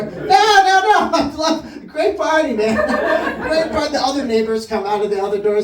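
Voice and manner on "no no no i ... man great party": high, raised-voice protest, drawn out; overeager denial. Acted. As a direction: deny it loudly and too eagerly. Acted denial while leaving.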